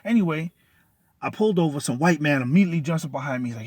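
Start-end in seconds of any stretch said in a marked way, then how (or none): none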